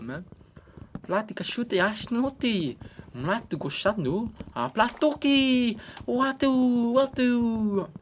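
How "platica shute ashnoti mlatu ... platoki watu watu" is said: Sims language. Acted. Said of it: "who talks like that".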